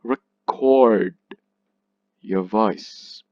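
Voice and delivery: Record with short re, strong long CORD; stress on second syllable. Shown as a demonstration. Teacher repeating the verb example, stress on CORD in Record. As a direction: lean hard on CORD in Record. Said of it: "This is the stressed one".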